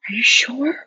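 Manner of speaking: whispery, high, rising disbelief; retold stunned question. To Diana about Germany news, stunned whisper.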